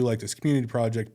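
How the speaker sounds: ordinary delivery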